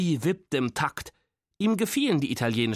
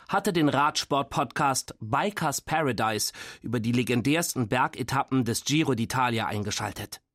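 usual speaking voice

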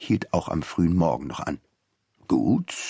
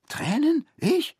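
much higher, mock-outraged disbelief; incredulous; slower than usual. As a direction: scoff at the very idea. Denies ever being near tears. (ungläubig, viel höher)

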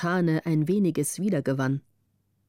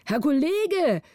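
higher urgent call; 7 semitones higher, slower than usual. A narrator's reading. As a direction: call out to him in alarm. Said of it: "riefen die hinter ihm". (Kollegen rufen ihm besorgt zu, höher)

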